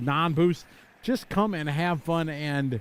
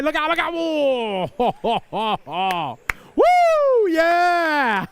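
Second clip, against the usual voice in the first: excited crash call; 6 semitones higher, 4 dB louder, much slower than usual. Real, not acted. Live crash in race, cheering.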